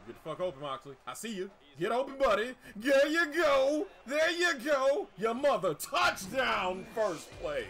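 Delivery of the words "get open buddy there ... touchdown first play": loud, high urging the receiver on; excited cheering. Real, not acted. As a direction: cheer him into the end zone, louder and higher.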